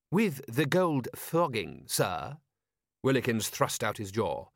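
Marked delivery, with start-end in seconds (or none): 0.1-2.4 lightly voiced proper butler; a hint of stiff, eager manservant; prim butler voice. Butler hopeful.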